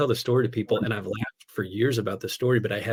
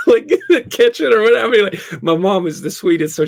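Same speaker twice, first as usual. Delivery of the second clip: laughing; 11 semitones higher, 9 dB louder than usual. Real, not acted. Laughing disbelief at mom's plastic.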